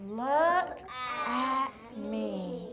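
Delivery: slow, sing-song read-aloud, stretching each word; pointing at each word as you read slowly; reading a poem to children. Acted. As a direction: lead the class through the poem line by line. Teacher rereads poem.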